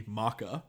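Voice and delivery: "maca" as MOCK-uh; "maca" said MOCK-uh, not MOH-kuh; mocked mispronunciation. Shown as a demonstration. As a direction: say the coffee word "maca" with a short ah, MOCK-uh. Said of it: "at least I don't pronounce".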